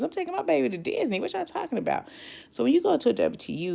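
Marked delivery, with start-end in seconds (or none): 0.0-2.1 much higher, fast, incredulous complaint; can't believe they'd cancel her trip; exasperated disbelief. Retold or imitated. Exasperated about missing cruise.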